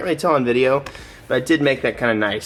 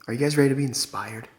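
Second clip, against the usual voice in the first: right in your ear; 4 semitones lower, 7 dB quieter than usual. Pretend. Hushed intro in viewer's ear.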